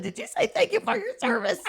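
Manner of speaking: high, laughing teasing question. Retold or imitated. Narrator teasing Mare, laughing high. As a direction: tease her through laughter. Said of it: "I said".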